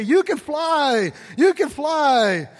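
high, slow, ringing preacher voice; preaching duck pastor. Acted. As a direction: proclaim it like a fired-up preacher. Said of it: "the duck pastor would say".

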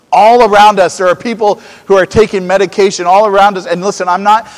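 rally the congregation; full-force preaching. Acted. Preaching builds again.

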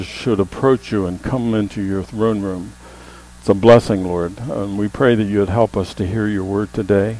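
hushed, reverent pastoral prayer. Real, not acted. Pastor asks God to help us hear his word, prayerful.

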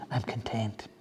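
a soft, secret whisper of peace. Real, not acted. Preacher confides his contentment to the Lord, whispered. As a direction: breathe it in a hushed whisper. Intimate confession of contentment to God.

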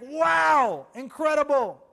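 loud, high, wowed exclamation; acted amazement. Preacher marvelling at what Simon just said, acted amazement. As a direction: burst out in mock awe. Acts out amazement at Peter's confession.

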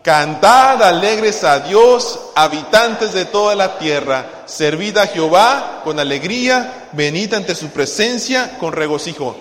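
loud, slow scripture declamation; congregational reading. Real, not acted. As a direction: lead everyone reading, loud and measured. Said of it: "todos juntos, así bonito".

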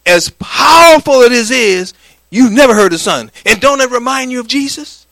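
ordinary talk, nothing marked

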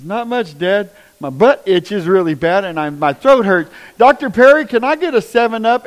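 put-on high, loud boyish voice calling out; voicing his groggy son. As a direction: play the kid just out of surgery, loud and high. Son waking from anesthesia, voiced by father.

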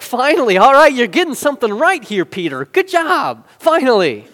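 loud, high mock-exasperated praise; high, punchy burst of relief; relieved cheering. Acted exasperated praise for Peter.

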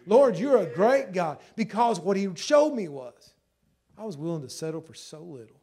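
loud, high preaching with bursts of laughter; a preacher reliving tears of joy; impassioned preaching. Real, not acted. Preaching his testimony, laughing, loud.

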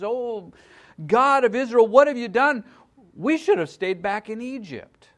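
high, loud, whiny lament; complaining Israelites, loud and high-pitched; loud wailing complaint. Retold or imitated. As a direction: wail at God like a grumbling crowd. Israelites complaining after Red Sea.

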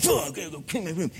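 rushed, grumbling mock complaint; awful-attitude child imitation. Kid sent to clean the room, rushed imitated grumbling. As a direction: grumble it fast like a put-upon kid. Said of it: "their attitude was awful".

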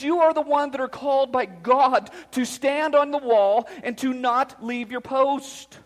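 rally the dads like troops; impassioned preaching. Real, not acted. Pastor rallying fathers to fight for families, impassioned preaching.

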